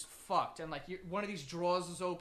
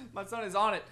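usual speaking voice

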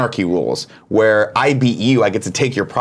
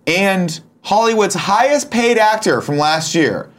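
higher, slow game-show build-up; hyped reveal build-up; 9 semitones higher, slower than usual. Acted. Teasing the co-host before the reveal, slow hyped announcer tone. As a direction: drag out the suspense like an announcer.

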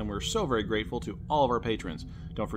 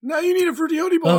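very high, loud jingle-like tease; loud put-on falsetto; playful high voice; 9 dB louder than usual. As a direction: squeal it like a silly ad slogan.

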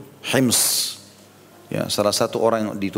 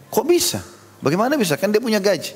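much higher, incredulous Umar; astonished question; 9 semitones higher than usual. Retold or imitated. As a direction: ask in disbelief how he can be poor. Umar astonished at poor governor. (heran tak percaya, nada jauh lebih tinggi)

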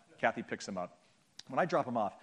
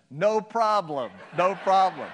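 loud, high, drawn-out mock reassurance; hammed-up, slow shouted forgiveness; overeager reassurance; 6 semitones higher, 10 dB louder, slower than usual. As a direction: call it out with exaggerated friendliness. Embarrassed driver eagerly forgives pastor.

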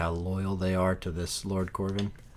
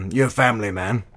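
usual speaking voice

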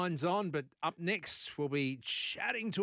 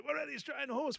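excited high question; 10 semitones higher, much faster than usual. Retold or imitated. Mates excited about the win.

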